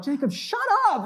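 high, loud, panicked shout; embarrassed yell. Retold or imitated. Boy at church yelling at his friend, embarrassed shout. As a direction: snap at your friend in a shrill yell. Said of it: "And I'm like".

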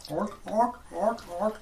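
high barking seal noises. Acted. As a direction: bark like a sea lion. GM voices barking sea lions.

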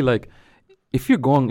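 ordinary talk

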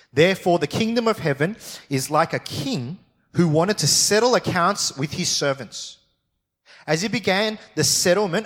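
preacher reading scripture aloud. Acted. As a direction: read the Bible passage out to the congregation. Reads the parable aloud.

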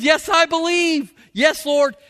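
high, slow mock declaration; hammed-up Martha. Preacher mimicking Martha's bold confession, high and mock. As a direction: playfully exaggerate her confident faith.

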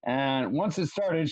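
ordinary talk, nothing marked

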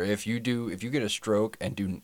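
usual speaking voice

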